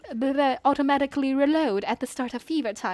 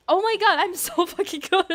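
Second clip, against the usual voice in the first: laughing brag; 7 semitones higher than usual. Real, not acted. Laughing after the win.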